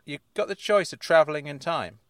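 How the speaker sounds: ordinary talk, nothing marked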